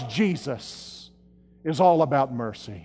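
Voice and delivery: Jesus drops low and soft; hushed refrain. Real, not acted.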